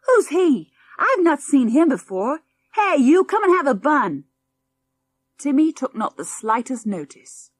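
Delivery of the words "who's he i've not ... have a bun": put-on high and loud, bossy kid; calling the dog. A narrator's reading. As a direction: yell across the room at the dog. Boy calls the dog over.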